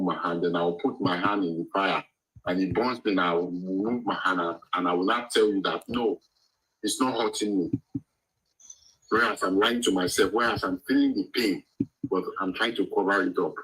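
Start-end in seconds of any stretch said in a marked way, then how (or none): none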